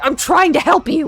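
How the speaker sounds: hammed-up exasperated guy; imitating exasperated friend. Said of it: "He's like".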